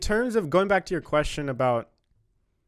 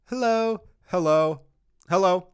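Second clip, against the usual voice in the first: short, firm hellos, a touch louder; quick firm hello; 3 semitones higher, 5 dB louder than usual. Shown as a demonstration. Showing quick firm ways to greet. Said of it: "It's a quick, firm".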